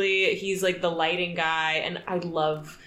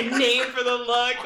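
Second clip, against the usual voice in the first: ordinary talk, nothing marked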